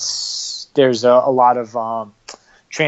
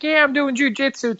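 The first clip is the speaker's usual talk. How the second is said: put-on much higher, chirpy valley-girl voice; mock a peppy American girl, pitched way up; 11 semitones higher than usual.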